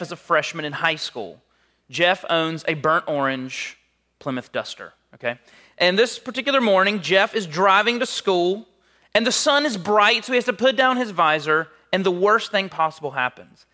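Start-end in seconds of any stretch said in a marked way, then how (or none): 5.8-13.6 raised, loud storytelling build; dramatic storytelling. Real, not acted. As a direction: drive the story toward disaster, high and loud.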